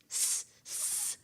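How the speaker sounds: "S, s" as a long unvoiced hiss; extended hissing S. Shown as a demonstration. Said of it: "It's this".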